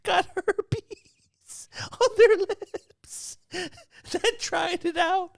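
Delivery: high, slow words broken by helpless laughter; can barely get it out for laughing; real giggling fit, high and slow; laughing through words. Real, not acted. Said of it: "laughing through the story".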